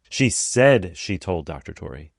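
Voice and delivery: SAID punched slowly, implying doubt; stress on SAID. Shown as a demonstration. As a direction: hit the word "said" hard and draw it out. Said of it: "implying that she didn't actually say it".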